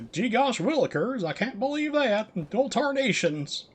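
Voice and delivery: high, hammed-up Southern twang. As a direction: play a wide-eyed country bumpkin in a Southern accent. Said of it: "stereotypical southern, southern voice".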